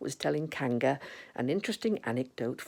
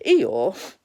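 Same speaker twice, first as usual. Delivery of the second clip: usual speaking voice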